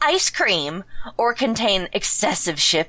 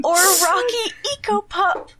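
high goofy voice; 10 semitones higher than usual. Pretend. Continues goofy pup voice.

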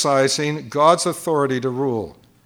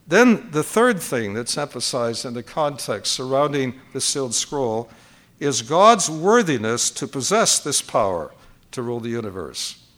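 normal talking voice

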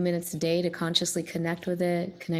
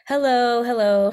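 loud hello through cupped hands; vintage echo chamber; 5 semitones higher, 7 dB louder than usual. Shown as a demonstration. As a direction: call hello into cupped ears. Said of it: "you sound kind of like you're in an echo chamber".

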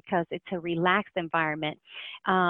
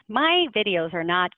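normal talking voice

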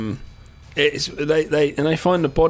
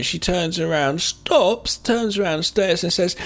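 ordinary delivery

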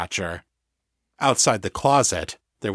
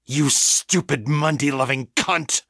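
higher-pitched venomous insult; enraged; 4 semitones higher than usual. A narrator's reading. Victor to the woman who fired at him, enraged. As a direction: hurl the insult in rage. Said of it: "a rage so complete".

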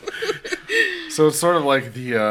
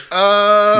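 a startled, loud, high "Uh"; Three's Company reaction; 9 semitones higher, 10 dB louder than usual. Retold or imitated. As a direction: freeze like a sitcom husband caught out. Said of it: "a Three's Company reaction".

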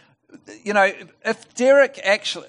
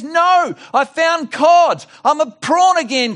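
much higher, louder, slow and triumphant; excited punchline; 11 semitones higher, 5 dB louder, slower than usual. Acted. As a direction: deliver the pun with glee.

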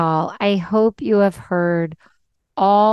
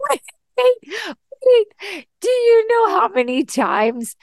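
excited interruption; 13 semitones higher than usual. Real, not acted. Host cutting in on guest's fruit story, excited high-pitched interruption. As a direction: jump in with a squeal of recognition.

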